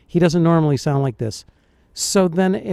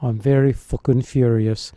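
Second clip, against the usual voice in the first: lower-pitched mimicked Northern English accent; imitated Northern English; 6 semitones lower than usual. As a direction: echo it in a Northern England accent. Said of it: "So he's from the north".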